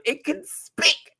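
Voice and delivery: throat giving out mid-word; voice cracking. Real, not acted. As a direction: voice catching in the throat. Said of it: "my throat".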